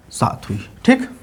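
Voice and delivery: ordinary talk, nothing marked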